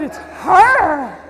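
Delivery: loud, mock-horrified exclamation; horrified recognition. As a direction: blurt it out in hammed-up dread. Recognizing the dreaded voice behind her.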